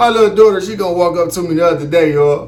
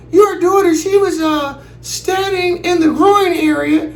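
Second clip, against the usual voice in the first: high, slow prim lady; prissy high office lady; school lady voice; 13 semitones higher, slower than usual. Retold or imitated. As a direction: school lady telling dad about his daughter, high and prim.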